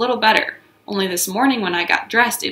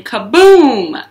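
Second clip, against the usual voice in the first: slow, loud stretched kaboom; 4 semitones higher, 8 dB louder than usual. Acted. Narrator hitting the kick, loud. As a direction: land the kaboom big. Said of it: "sound effect".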